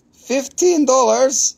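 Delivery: high, drawn-out disbelieving Fifteen dollars?; mock surprise. Real, not acted. Seller echoing the price to viewers, mock shocked.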